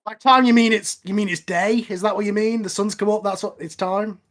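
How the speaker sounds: ordinary talk, nothing marked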